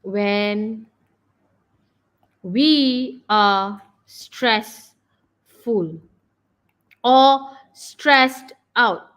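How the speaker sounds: long pauses, each word held and pressed; dictating while writing. Real, not acted. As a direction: spell it out word by word as you write. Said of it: "I'm gonna write down here for you".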